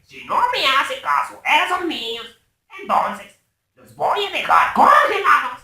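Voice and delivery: villain voice, slow and loud with shouted peaks; hammy supervillain bragging and threatening; Gru with Russian accent. Shown as a demonstration. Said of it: "llegué a esa perfección y le decía algo como".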